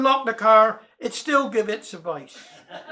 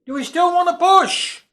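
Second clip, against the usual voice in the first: shouted call; 6 semitones higher, slower than usual. A narrator's reading. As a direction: shout into the dark street. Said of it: "So he shouted".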